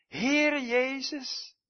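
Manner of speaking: slow, high, loud calling out to the Lord; a dying man's fervent prayer, called out slow and high; fervent cry. Acted. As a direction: cry his name out to heaven, drawn out. Quoting Stephen's dying prayer.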